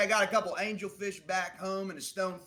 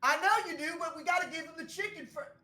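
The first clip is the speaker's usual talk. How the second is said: raised-voice scolding; 13 semitones higher, faster than usual. Acted. Clerk keeps yelling at manager.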